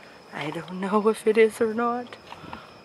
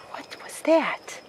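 scared whisper; 9 semitones higher, slower than usual. Real, not acted. Hears a noise.

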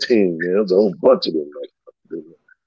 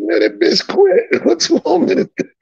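incredulous retort. Retold or imitated. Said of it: "I said".

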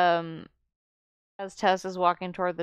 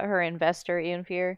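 normal talking voice

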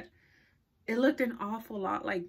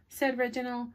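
plain reading voice

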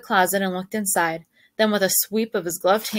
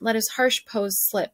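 usual speaking voice

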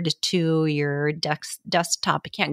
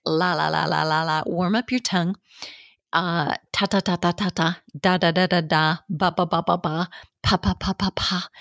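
repeated la ta da ba pa syllables; vocal warm-up. Shown as a demonstration. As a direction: warm up the tongue with quick syllables. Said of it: "So you too should warm up".

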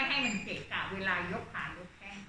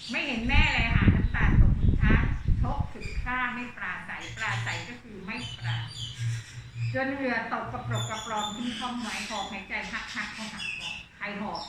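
poem recital; 5 dB louder than usual. Acted. Teacher reads verse passage.

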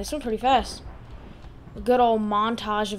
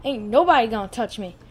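ordinary talk, nothing marked

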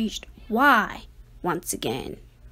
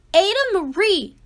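quick louder repeat, falling; scolding, faster; 6 semitones higher, 5 dB louder, faster than usual. Acted. Parents scolding their daughter, a fast falling call. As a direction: hurry the second call down.

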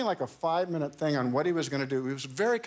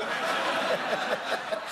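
breathy, high laugh; 6 semitones higher than usual. Real, not acted. Laughing at own golf story.

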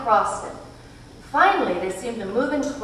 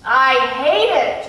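loud, slow, heated outburst; passionate cry; 5 semitones higher, 8 dB louder than usual. A narrator's reading. A small boy crying out against the dark thing, loud and slow. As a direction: spit out the hatred. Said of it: "Charles Wallace cried passionately".

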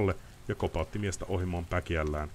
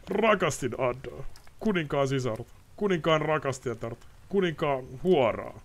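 bitter confession; 10 semitones higher than usual. Acted. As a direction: pour out a guilty secret. Ostrid's confession.

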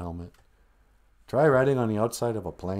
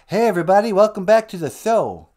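high, loud stage greeting; raised, projected showman voice; 9 semitones higher, 9 dB louder than usual. Acted. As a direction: hail the crowd like a rock frontman. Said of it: "then there's [name]".

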